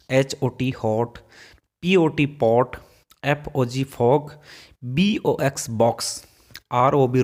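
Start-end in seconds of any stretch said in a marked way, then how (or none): 0.7-1.2 हॉट, slow, with an open ऑ; हॉट धीरे, खुले ऑ के साथ; slow demo word. Shown as a demonstration. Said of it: "नॉट, हॉट इस तरह से होगा". 3.8-4.3 फॉग, slow, with an open ऑ; फॉग धीरे, खुले ऑ से बोलें; slow demo word. Shown as a demonstration. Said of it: "उच्चारण हो गया ओ". 5.7-6.2 बॉक्स, slow, with an open ऑ; बॉक्स धीरे, खुले ऑ से बोलें; slow demo word. Shown as a demonstration. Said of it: "उच्चारण हो गया ओ".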